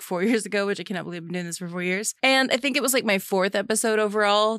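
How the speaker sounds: normal talking voice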